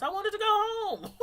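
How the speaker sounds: high-pitched plaintive wail on the edge of laughter; exasperated whine. Real, not acted. Tired worker barred from her house, comic whine. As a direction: whine it high, about to crack up.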